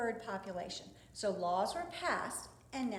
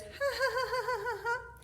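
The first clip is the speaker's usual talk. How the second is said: high bird-like ha ha ha laugh, much higher; imitated dove laugh; 12 semitones higher than usual. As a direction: mimic the laughing dove's call up high. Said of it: "She laughs, she goes".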